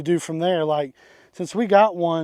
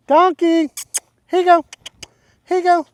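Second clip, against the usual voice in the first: high, louder coaxing voice for an animal; pet talk; 11 semitones higher, 5 dB louder than usual. Real, not acted. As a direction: coax the donkey up high, like talking to a pet. Coaxing the donkey with a ball.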